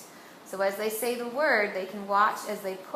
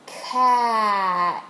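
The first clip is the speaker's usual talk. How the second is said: "Cat" loud, its sounds stretched out; said slowly; 7 dB louder than usual. Shown as a demonstration. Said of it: "watch as I push a penny".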